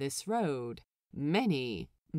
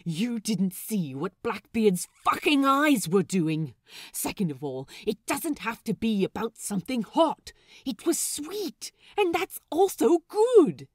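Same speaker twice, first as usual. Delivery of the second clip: countering; 6 semitones higher, 7 dB louder than usual. A narrator's reading. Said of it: "Lucius counters".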